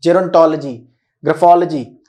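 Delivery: "gerontology, ग्राफोलॉजी" stressed on the OL, ending -uh-jee; corrected pronunciation. Shown as a demonstration. Teacher correcting how many people say लॉजी, with stressed OL. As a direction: say jeron-TOL-uh-jee, gra-FOL-uh-jee. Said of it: "लॉजी नहीं होता है".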